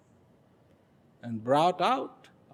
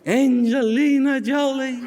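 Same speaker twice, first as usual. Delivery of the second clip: high, loud mock swoon; awed dreamer; 8 semitones higher, 5 dB louder than usual. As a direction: swoon over a star's name. Mocks dreamer awed by film star.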